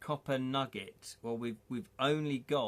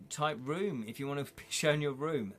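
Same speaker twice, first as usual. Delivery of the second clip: ordinary talk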